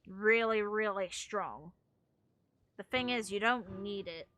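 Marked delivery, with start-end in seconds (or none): none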